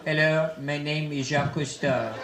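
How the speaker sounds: put-on slow, low French-accented documentary narrator; Jacques Cousteau. Explorer introducing a nature film on mating habits, French accent. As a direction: narrate gravely in a thick mock French accent. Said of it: "a creature Jacques Cousteau would find".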